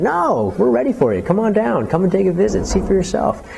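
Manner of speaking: eager rebuttal. Retold or imitated. Local urging a doubter to visit New Orleans, eager and high-pitched. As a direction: protest brightly, voice lifted right up, urging them to come. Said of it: "being the opposite and saying".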